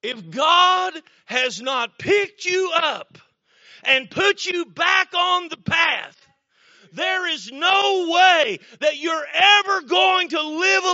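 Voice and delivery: slow, high-pitched preacher's declaration; emphatic preaching. Acted. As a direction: drive each phrase home from the pulpit, slowly. Preaches the climax with emphatic force.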